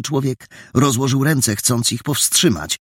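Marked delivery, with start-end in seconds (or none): none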